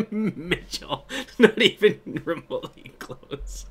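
Mitchell said through real laughter; giggling disbelief at the name; laughing. Real, not acted. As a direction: laugh out not even remotely close. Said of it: "That's hilarious".

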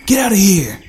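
loud shout at a giant bird; yelled. Acted. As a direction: bellow it to drive the bird away. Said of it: "yelled Geoff".

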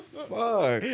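ordinary talk

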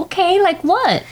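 high character voice. Acted. S1 plays along in high voice.

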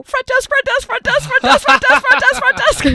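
high terrified shrieks over and over; frantic high yelling for the front desk; panicked screaming. Retold or imitated. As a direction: scream for help like you're trapped. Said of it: "and I go".

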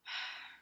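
heavy, soft sigh of dismay; withering sigh. Real, not acted. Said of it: "Sorry, just a heavy sigh".

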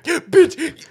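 ordinary delivery

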